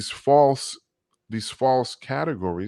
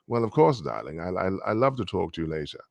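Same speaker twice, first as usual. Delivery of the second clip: upper-class talk; 6 semitones lower than usual. Shown as a demonstration. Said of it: "If you talk like this".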